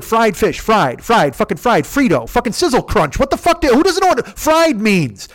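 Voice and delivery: high, loud exasperated rant; losing his mind. Retold or imitated. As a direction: blow up in furious disbelief. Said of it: "I wanted to lose my mind and say that shit".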